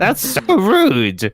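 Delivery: high prim scolding voice; Miss Hardbrook impression. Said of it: "Miss Hardbrook is just like".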